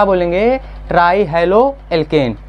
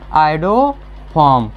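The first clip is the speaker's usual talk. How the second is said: slow and lower, syllables stretched; drawn-out key term; 4 semitones lower, much slower than usual. Real, not acted. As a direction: let the answer fall slowly, held long. Teacher answers own question with key term.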